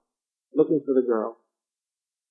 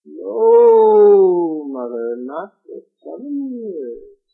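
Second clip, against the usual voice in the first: groaning tree; 5 semitones higher, 5 dB louder, much slower than usual. A narrator's reading. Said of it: "The apple tree groaned and said".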